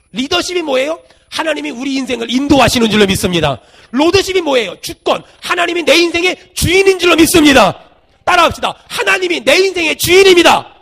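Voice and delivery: loud, emphatic declarations of faith; full-force preaching. Real, not acted. Preacher leading the congregation in declarations of faith, full-force preaching. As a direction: proclaim it loudly for the congregation to repeat.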